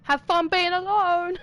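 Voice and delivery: loud, slow taunting call; sarcastic farewell shout; mocking shout. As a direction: yell it after them, mocking. Mock taunt at departing character.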